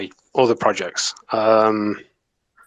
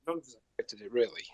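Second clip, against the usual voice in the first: whispering; hushed under the breath; whispered aside; 16 dB quieter, faster than usual. Real, not acted. Aside after amazing.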